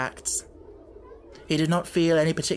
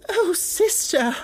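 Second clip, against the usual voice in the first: slow, much higher, tearful; sniffling plea; 4 dB louder, slower than usual. Acted. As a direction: whimper it through tears. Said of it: "she sniffed".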